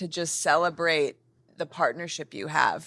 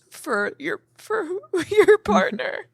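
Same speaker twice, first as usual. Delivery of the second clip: higher, choked voice breaking into a laugh; emotional, near tears; 13 semitones higher than usual. Real, not acted. Host tearing up thanking guest's partner, voice breaking. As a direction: voice cracking with gratitude. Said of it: "I'm emotional because I feel so grateful".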